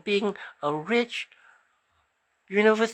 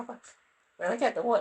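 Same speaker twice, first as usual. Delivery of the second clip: ordinary talk, nothing marked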